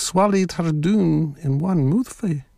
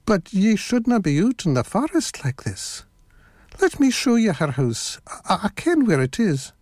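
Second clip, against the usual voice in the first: higher-pitched, lightly voiced wolf; coaxing wolf; 6 semitones higher than usual. A narrator's reading. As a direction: coax her along as the storybook wolf.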